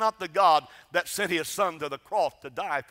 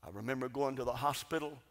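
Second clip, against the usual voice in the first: much softer, lower, intimate storytelling; soft tender remembering; 4 semitones lower, 7 dB quieter than usual. Real, not acted. Preacher to congregation, speaking tenderly of his sister Charlotte. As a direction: hush the room to tell about a dear sister.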